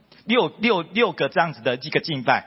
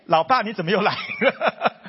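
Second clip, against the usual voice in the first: laughing surprise; 5 semitones higher than usual. Retold or imitated. Surprised at father returning.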